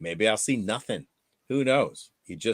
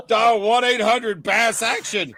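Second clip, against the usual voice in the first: mock ad announcer; 9 semitones higher, 5 dB louder, slower than usual. Joins the fake ad.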